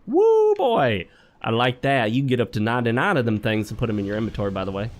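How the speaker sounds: put-on whooped opening, then easy Southern accent. Gamer excited over his loot haul, light Southern accent. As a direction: throw in a little folksy Southern twang. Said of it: "I threw a little southern accent in there".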